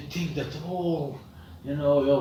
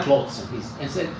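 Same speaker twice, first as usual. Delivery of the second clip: ordinary delivery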